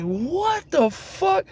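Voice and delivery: drawn-out high incredulous squeal; disbelief. Retold or imitated. Retelling his reaction to the deportations, a slow high squeal of disbelief. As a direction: stretch it out in pure disbelief.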